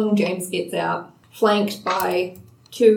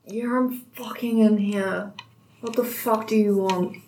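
put-on grumpy drunk; 4 dB quieter than usual. GM voices grumpy Greg.

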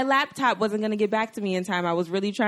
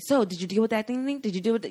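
nosy people asking; 4 semitones higher, faster than usual. Retold or imitated. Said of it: "without people asking me".

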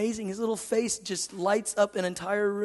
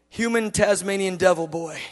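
ordinary talk

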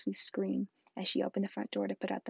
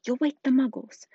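higher, louder warning; sharp scolding; 4 semitones higher, 10 dB louder than usual. Acted. McGonagall warning Hagrid about the Muggles, loud sharp scold.

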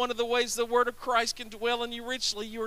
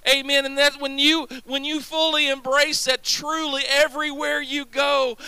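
louder on each everywhere you go; preaching shout; 4 dB louder than usual. Real, not acted. Preacher rallying the congregation, climbing to a shout. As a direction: build the crowd up with each line.

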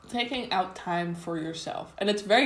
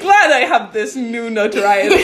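laughing; 4 semitones higher, 14 dB louder than usual. Retold or imitated. Retold reply, laughing.

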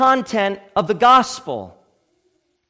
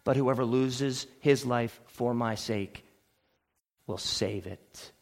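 low, soft, weighty delivery; solemn hush; 10 semitones lower, 8 dB quieter than usual. Real, not acted. Preacher telling congregation God is speaking, solemn hush. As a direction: sink almost to nothing, leaving long pauses.

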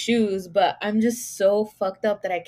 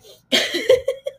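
sudden high airy giggle; embarrassed squeal of laughter; high breathy laugh; 8 dB louder than usual. Real, not acted. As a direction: burst out laughing at yourself. Laughing at own story.

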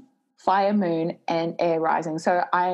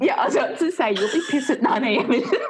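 laughing; 5 semitones higher, faster than usual. Real, not acted. Laughing at friend's drunk idea.

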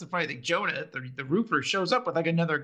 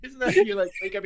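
ordinary talk, nothing marked

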